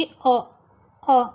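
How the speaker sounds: a short, light "अ" with no stress; weak schwa sound. Shown as a demonstration. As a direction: say "अ" as a barely-there uh. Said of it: "letter ए का sound एकदम हल्का सा".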